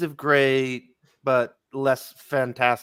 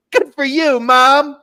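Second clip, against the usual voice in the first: put-on very high, louder toddler voice; two-year-old voice; 12 semitones higher, 8 dB louder, much slower than usual. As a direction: squeak it out like a tiny kid cheering mom on. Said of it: "imagine a two-year-old going like".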